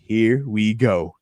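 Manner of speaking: slow, stretched hype call; drawn-out here we go. Real, not acted. Streamer hyping football to viewers, slow drawn-out call. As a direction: savor each word, slowly.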